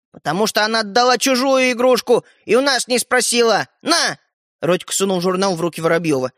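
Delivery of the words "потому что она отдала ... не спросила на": higher, louder indignant accusation. Acted. Brother accusing sister of giving away a toy, indignant. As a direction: protest hotly, pitched up.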